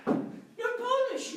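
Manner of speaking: high, drawn-out pronouncement; startling diagnosis. Acted. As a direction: deliver the diagnosis slowly up high. Doctor gives the x-ray result.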